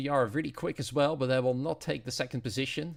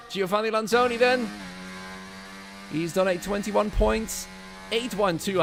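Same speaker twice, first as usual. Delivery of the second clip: high, loud play-by-play; live race commentary; 8 semitones higher, 7 dB louder than usual. Acted. Commentator calling the final cars across the line, excited play-by-play. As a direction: call the finish with raised, driving energy.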